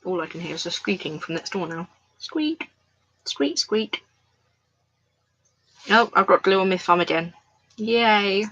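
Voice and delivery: ordinary talk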